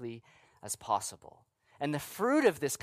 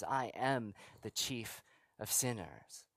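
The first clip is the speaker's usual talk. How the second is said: slower and much softer, sinking low; hushed confession; 5 semitones lower, 10 dB quieter, slower than usual. Acted. To the congregation, repeating Paul's words in a hushed confession. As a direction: let it fall quiet like a heavy admission.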